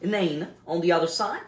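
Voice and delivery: ordinary talk, nothing marked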